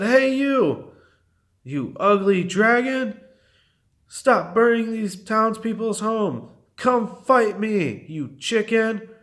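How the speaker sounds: high-pitched taunting call. A narrator's reading. Princess witch challenging the dragon, taunting. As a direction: goad the enemy into a fight.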